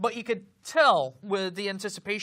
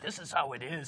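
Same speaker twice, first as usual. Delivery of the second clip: lower, softer, understated imitation; matter-of-fact; 6 semitones lower, 8 dB quieter than usual. Imitating the film's unheroic rescuer, matter-of-fact and low-key. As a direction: quote him quietly, no heroics. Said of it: "giving you that stare and he's looking into your soul".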